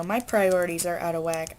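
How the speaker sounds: ordinary delivery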